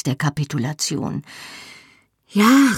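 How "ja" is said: high, loud cry of yes; woman crying out in passion, high and loud; cried yes. A narrator's reading. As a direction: call it out in ecstasy. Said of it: "rief ich".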